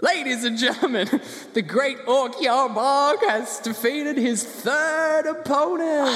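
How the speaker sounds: high-pitched, drawn-out arena announcing; ring announcer. Acted. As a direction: proclaim the champion like a fight announcer. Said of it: "And they said".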